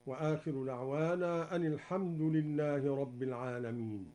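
usual speaking voice

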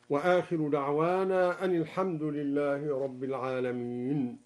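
ordinary talk

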